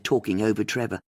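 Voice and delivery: ordinary delivery, nothing marked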